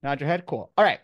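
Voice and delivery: ordinary talk, nothing marked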